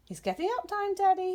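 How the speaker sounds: high, loud wake-up call; shouting. Acted. Owlet waking his father with a loud high shout. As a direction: jolt daddy awake with a shout. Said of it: "he shouted".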